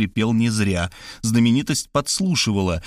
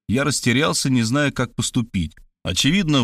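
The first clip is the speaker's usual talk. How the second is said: plain reading voice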